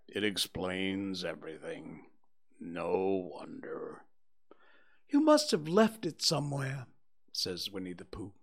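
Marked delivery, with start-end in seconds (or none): 0.1-4.0 low, quiet, resigned donkey; gloomily. Acted. 5.1-6.9 a friendly, eager little bear; cheerful bear Pooh. Acted.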